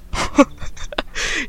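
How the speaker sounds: breathy, voiceless chuckle; amused laugh. Real, not acted. Host amused at her own fun fact, soft real chuckle. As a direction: laugh softly at your own joke.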